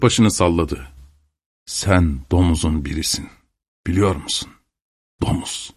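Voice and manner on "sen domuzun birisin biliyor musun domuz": low, hushed voice, fading almost to nothing; low menacing insult. A narrator's reading. Sexton sidling up to insult Stepan, low and menacing. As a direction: lean in and insult him softly, with pauses.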